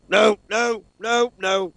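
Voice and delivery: alarmed, rising panic; panicked no. Retold or imitated. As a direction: blurt out in fright. Friend panicking at ghost video.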